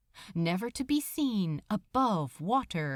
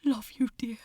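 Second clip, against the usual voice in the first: hushed, rushed and forced light; strained casual whisper; faster than usual. Acted. Aziraphale whispering love to Crowley before the water, strained. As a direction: toss it off while terrified. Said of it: "high rush of strained nonchalance".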